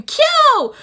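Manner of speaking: loud, very high cry of a name; high-pitched mock scream; imitated scream. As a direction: imitate a character screaming out in alarm. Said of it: "you hear Toru scream".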